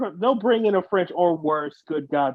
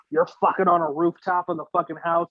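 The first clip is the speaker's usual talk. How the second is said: ordinary talk, nothing marked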